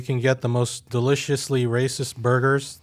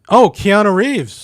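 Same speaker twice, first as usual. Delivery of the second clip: delighted; 5 semitones higher, 6 dB louder, much slower than usual. Real, not acted. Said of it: "Finally, something decent".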